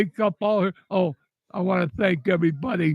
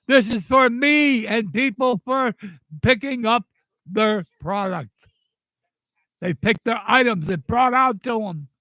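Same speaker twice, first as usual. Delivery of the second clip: raised-voice lecture that builds to a shout; retold angry scolding; 4 semitones higher than usual. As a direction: scold her out of the pickup spot. Yelling at the lady parked there.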